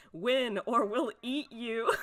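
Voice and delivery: slow, loud poster slogan, amused; mock threat. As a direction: draw out a playful threat.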